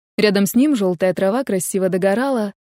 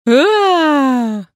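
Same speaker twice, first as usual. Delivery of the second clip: loud, higher drawn-out yowling; cat's yowl; 5 semitones higher, 5 dB louder than usual. A narrator's reading. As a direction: yowl like a wailing cat. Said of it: "кричал кот".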